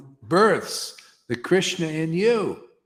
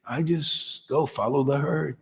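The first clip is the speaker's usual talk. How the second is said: ordinary talk, nothing marked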